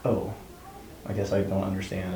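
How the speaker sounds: put-on soft, deflated little kid; small childlike voice. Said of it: "reduced back to this little kid".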